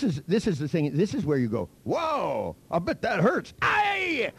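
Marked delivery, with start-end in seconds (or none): 1.8-4.3 rising to a high yelp; mock pain alarm. Someone noticing their cut finger, exaggerated pained alarm. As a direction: act out escalating alarm, ending in a shrieked cry.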